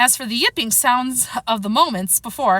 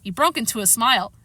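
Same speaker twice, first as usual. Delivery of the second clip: plain reading voice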